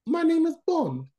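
high-pitched mock spy introduction; hammed-up Bond impression; cartoon hero catchphrase. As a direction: deliver the catchphrase high and showy. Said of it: "I only remember that he used to say".

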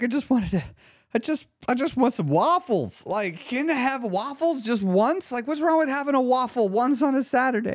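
high-pitched mock begging; whiny, bargaining inner voice, hammed-up; pleading. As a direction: replay a desperate little plea to yourself. Replays inner debate begging for waffles.

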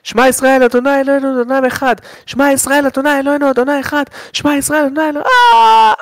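high chanted prayer breaking into a scream; mock desperate prayer of a burning man; imitated screamed prayer. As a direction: cry the prayer high, then shriek. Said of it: "והוא צורח".

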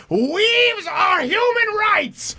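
high, slow, shouted stump speech; Bernie Sanders impression. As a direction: bellow it like a fired-up candidate. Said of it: "Bernie Sanders".

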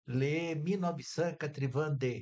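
recited French. Retold or imitated.

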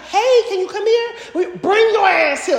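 high calling, then slowed demand; firm mom call. Retold or imitated. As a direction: call the kid over, half laughing. Mom calling kid firmly.

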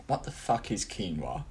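inner thought aside. Retold or imitated. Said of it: "inside, you're going".

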